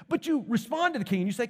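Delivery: normal talking voice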